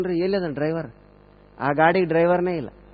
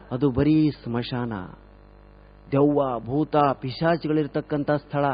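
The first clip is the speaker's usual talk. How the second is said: low, slow and soft, like telling a ghost story; spooky hushed warning; 4 semitones lower, 5 dB quieter, slower than usual. Acted. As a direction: lower the voice to scare the listener. Spooky warning about graveyard.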